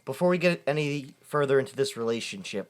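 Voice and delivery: ordinary delivery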